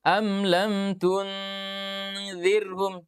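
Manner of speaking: "tunzirhum" drawn out very slowly with the nasal placed too late; late ikhfa demo. Shown as a demonstration. Teacher showing the wrong, late ikhfa, very slow.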